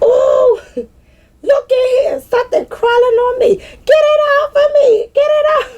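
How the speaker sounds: high, loud, hammed-up panicked shriek while laughing; mock panic. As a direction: play the girl freaking out over a bug, laughing. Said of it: "She was like".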